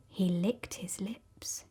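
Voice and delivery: a slow whisper, savouring it; hushed, lip-smacking storyteller whisper. A narrator's reading. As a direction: breathe the words like a hungry secret. Narrator savouring the crocodile's greed.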